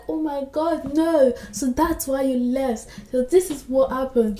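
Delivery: high-pitched acted shock at a reveal; surprised reader. Reader finally learning why she left, surprised. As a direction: react as if the twist just landed. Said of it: "it's surprising".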